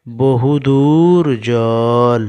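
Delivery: very slow word-by-word reading; reading without spelling. Shown as a demonstration. Teacher reads the line to pupils without spelling, slow and drawn out. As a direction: stretch each word out for early readers. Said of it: "এবার আমরা বানান ছাড়াই পড়ার চেষ্টা করি".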